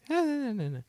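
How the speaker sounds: imitating own voice breaking; cracking voice. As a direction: voice cracking up high, squeaky. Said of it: "my voice was like".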